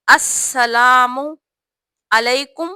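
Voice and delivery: very slow, السلام علیکم ورحمۃ اللہ with pauses between words; slow word by word salaam. Shown as a demonstration. Teacher dictating the salaam word by word. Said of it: "یہ ہے صحیح word".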